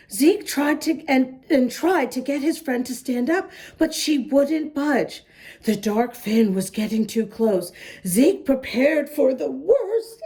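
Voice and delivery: ordinary delivery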